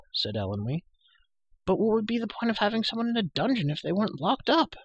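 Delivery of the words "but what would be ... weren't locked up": put-on high-pitched, matter-of-fact girl at the dungeon grating; lightly voiced chatterbox girl, pitched well up; prattling girl, incredulous. Girl questioning a prisoner locked in a dungeon, incredulous.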